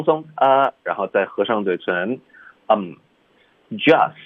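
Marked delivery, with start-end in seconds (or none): 3.7-4.1 "just" said with the final t dropped; linked just. Shown as a demonstration. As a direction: clip the t off "just". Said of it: "这个特的音彻底省".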